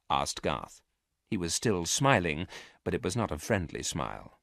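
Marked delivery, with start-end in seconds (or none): none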